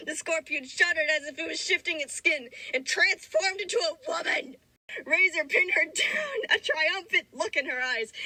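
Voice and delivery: heightened, mock-epic narration; story narration. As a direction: read the fanfic like an epic saga. Dramatic fanfic read aloud.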